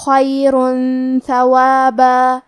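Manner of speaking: slow chant, end of خیرن held as a hum before ثوابا; hidden nasal n. Shown as a demonstration. Teacher shows ikhfa, chanting خیرن ثوابا with held hum. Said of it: "غنہ کے ساتھ ادا کریں گے".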